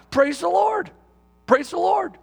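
blurted out. Retold or imitated. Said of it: "he just kind of blurted out".

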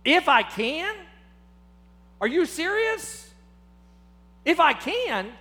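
a teacher stunned by a student's doubt; amazed disbelief. Retold or imitated. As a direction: echo the words back in disbelief. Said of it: "He is amazed by the man's ignorance".

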